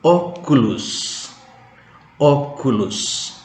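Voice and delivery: Oculus, slow, with the O stressed. Shown as a demonstration. Teacher spells out Oculus slowly, stress on the O. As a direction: say Oculus slowly, leaning on the first O. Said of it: "Tekannya di O".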